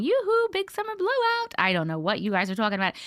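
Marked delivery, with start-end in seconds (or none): 0.0-1.5 put-on sing-song Swedish accent, pitched higher; a playful stab at a Swedish accent, higher up. Host answers a chat request with a higher Swedish accent. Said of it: "In my best Swedish accent".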